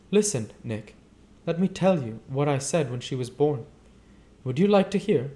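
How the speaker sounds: a hint of a breathless society wife; Daisy confiding. Daisy confides in Nick about her daughter, lightly voiced.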